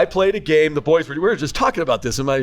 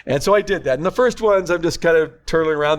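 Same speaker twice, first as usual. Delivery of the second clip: ordinary delivery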